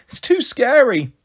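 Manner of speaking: put-on high, slow scared-child wail; roared protest. A narrator's reading. Small boy afraid to board the pod, wailing protest. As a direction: drag it out like a frightened little boy. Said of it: "Kenny roared".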